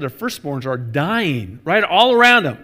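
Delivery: normal talking voice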